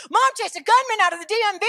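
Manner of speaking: high, fast, loud blurting; imitated tattling son. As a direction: blurt the news like a kid telling on Mom. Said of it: "He's like".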